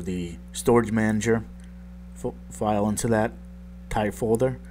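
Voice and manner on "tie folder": ordinary talk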